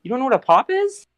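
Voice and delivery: very high, disbelieving pop question; shocked, voice jumping way up; incredulous squeak. Retold or imitated. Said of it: "I'm like".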